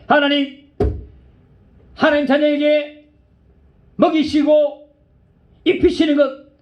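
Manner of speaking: half-laughing complaint, pitch up; arguing tearful prayer. Retold or imitated. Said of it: "따지면서 울면서 막 기도를 했습니다".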